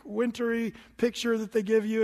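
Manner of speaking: normal talking voice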